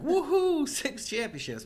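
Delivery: high, loud whoop with a laugh; imagined cheering. Retold or imitated. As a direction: cheer like a fan whose team just won. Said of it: "I would have been like".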